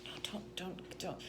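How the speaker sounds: barely voiced, urgent whisper; hushed protest. Touching her defender's arm, whispering to stop him. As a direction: whisper it to hold someone back.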